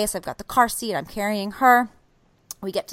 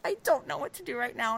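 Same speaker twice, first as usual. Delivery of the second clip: high, small, soft voice; softly overwhelmed, pitched up; imitated tearful helplessness; 5 semitones higher, 5 dB quieter than usual. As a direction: act out being close to tears. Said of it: "my eyes are welling up with tears".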